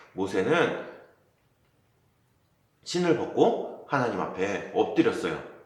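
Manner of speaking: usual speaking voice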